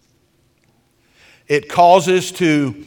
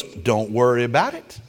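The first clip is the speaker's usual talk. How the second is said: softer, lower easygoing reassurance; gracious reassurance; 5 semitones lower, 5 dB quieter than usual. Retold or imitated. Husband reassuring the hostess, soft and gracious. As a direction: brush it off kindly. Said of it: "He goes".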